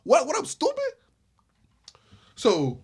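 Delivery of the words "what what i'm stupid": incredulous. Real, not acted. Incredulous rhetorical question.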